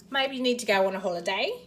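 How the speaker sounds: amateur Cockney-style British accent; playful attempt at a London accent. Shown as a demonstration. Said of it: "was that a terrible accent or passable?".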